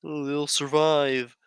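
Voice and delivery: high, slow, snide mock quote; mocking dismissive. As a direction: drawl it out dismissively.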